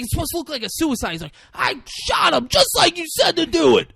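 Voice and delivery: high, loud hammed-up defensive yell; mock shouted protest. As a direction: yell back like a wronged henchman. Retold argument between movie characters.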